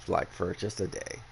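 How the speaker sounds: normal talking voice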